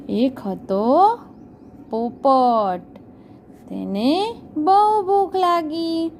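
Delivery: put-on slow, drawn-out story voice for small children; story narration for young pupils; storytelling to children. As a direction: tell it slowly, like a bedtime tale.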